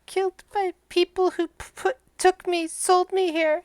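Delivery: high, soft, halting creature voice; sad flying monkey. Acted. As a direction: haltingly recount a loss as a little monkey. Monkey tells its sad story.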